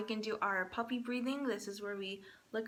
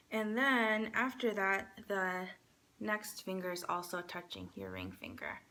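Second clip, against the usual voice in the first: normal talking voice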